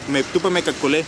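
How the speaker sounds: ordinary delivery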